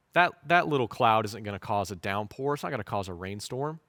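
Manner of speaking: mock a doubter brushing off hope, quietly; doubtful dismissive voice. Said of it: "our temptation would be".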